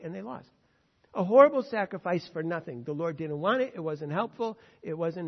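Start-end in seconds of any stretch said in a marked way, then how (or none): none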